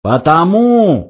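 drawn-out policeman's shout; yelling. A narrator's reading. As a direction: stretch it out as an exasperated yell. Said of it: "заорал полицейский".

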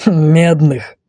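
mildly scornful bandit; understated sneering correction; contemptuous. Acted. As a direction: dismiss it with a touch of contempt. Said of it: "Разбойник презрительно хмыкнул".

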